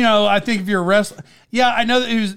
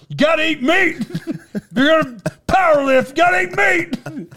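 high, hammed-up shouting powerlifter; mock meathead shout, laughing between phrases; hardcore powerlifter; 9 semitones higher than usual. Imitating his powerlifting dad and stepmom, mock shouting.

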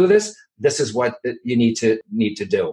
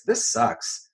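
higher, softer, whispered put-down; whispered disgust; 7 semitones higher, 6 dB quieter than usual. Retold or imitated. A writer rereading an old draft, whispered disgust. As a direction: hiss it like you hate your own draft. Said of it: "You're gonna say this".

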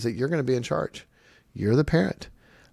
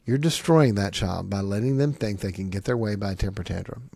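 normal talking voice

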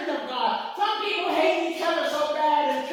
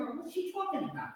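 puzzled aside; 14 dB quieter than usual. Retold or imitated.

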